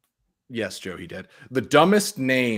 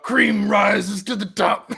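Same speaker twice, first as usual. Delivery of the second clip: lightly voiced wrestler; 7 semitones higher, 5 dB louder, slower than usual. Repeating the wrestler's cream bit.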